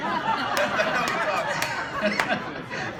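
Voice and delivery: loud, high burst of laughter; big laugh. Real, not acted. Host laughing out loud at the speaker's fake accent. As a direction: crack up at the accent joke.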